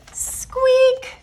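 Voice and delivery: tiny high kitten squeak. A narrator's reading. Narrator voicing the kitten, a high squeak.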